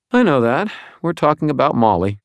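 ordinary delivery

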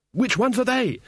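put-on high, urgent young voice; panicked question. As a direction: ask desperately while running. Diamanda asks while fleeing.

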